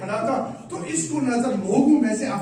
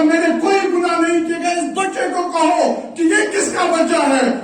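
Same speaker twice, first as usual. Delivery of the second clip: loud, higher shout; shouted denial; 7 semitones higher, 5 dB louder than usual. Retold or imitated. Hermit shouting his innocence to the king, loud. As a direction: shout it to the whole crowd.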